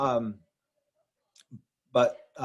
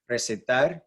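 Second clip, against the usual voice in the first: Recetar with the final -tar stressed; Spanish Recetar, land on the last syllable. Shown as a demonstration. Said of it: "tar is where the stress syllable is".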